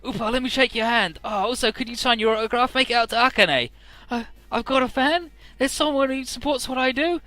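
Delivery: excited fan. Retold or imitated. Fans and mascot excited.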